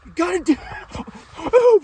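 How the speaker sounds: loud excited yell; excited shout. Real, not acted. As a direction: yell it out as the bird drops. Shot taken, excited yelling.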